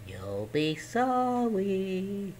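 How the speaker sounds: slow, soft, drawn-out threat; ominous goblin warning. Acted. Small goblin warning Herschel, slow and soft. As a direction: let the warning creep out slowly. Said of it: "said the goblin".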